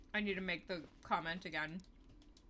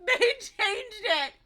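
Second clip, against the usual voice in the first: high, loud, slow laughing cry of disbelief; 13 semitones higher, 10 dB louder, much slower than usual. Real, not acted. Streamer sees the game changed, laughing disbelief.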